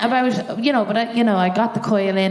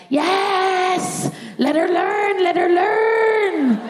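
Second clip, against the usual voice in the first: much higher, shouted in agony; desperate yell; 11 semitones higher, much slower than usual. Retold or imitated. Patient in pain yelling permission to the nurse. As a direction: beg through the pain, shouting. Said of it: "I'm like".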